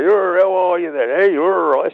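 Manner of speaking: teasing colleagues mimicking a Devon accent; mock West Country accent. Colleagues ribbing the new lad, mock West Country accent. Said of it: "they all thought I got this accent".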